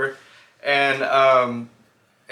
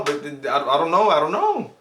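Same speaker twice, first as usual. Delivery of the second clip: ordinary talk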